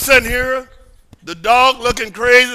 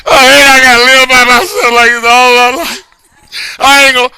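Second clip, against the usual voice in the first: louder, pleading cry to God, half-performed; imitating his own tearful prayer, raised voice; retold crying prayer; 2 semitones higher, 6 dB louder than usual. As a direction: replay a lonely man's desperate plea. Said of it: "I'm crying".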